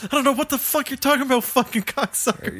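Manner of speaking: fast, high, snarling mock tough guy; angry thug quote. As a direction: spit it out like a foul-mouthed teen. Quoting Ricky's swearing at end.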